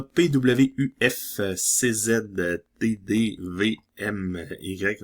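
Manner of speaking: letters read out slowly, one by one; reading an eye chart. Shown as a demonstration. Reading scratch-card letters slowly like an eye chart. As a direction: spell them slowly like at the eye doctor. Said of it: "On dirait que je suis l'ophtalmo".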